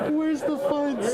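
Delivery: high laugh then slow squeaky punchline. Real, not acted. Streamer cracking up at the slogan, high laughing voice. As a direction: laugh it out, then savour the line.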